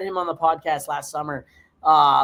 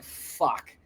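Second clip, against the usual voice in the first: soft, breathless curse under the breath; exasperated hushed swear; muttered curse; 5 semitones lower, 7 dB quieter than usual. Retold or imitated. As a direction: let it slip out, barely voiced. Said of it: "I'm like".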